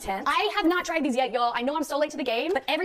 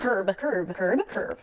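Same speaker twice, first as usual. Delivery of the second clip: "curb" said crisply, four times over; rehearsing "curb" as if correcting herself; repeating the word; 5 semitones lower, much slower than usual. Shown as a demonstration. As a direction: drill the word "curb", each one clipped and even. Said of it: "curve your cravings".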